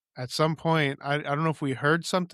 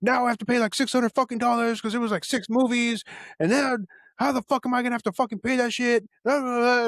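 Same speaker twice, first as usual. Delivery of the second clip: angry dad yelling; 8 semitones higher than usual. Retold or imitated. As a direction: rant like an enraged father. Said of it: "he starts yelling at me".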